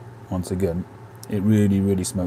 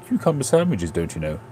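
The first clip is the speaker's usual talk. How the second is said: mock posh. Mocking posh Ritz afternoon tea.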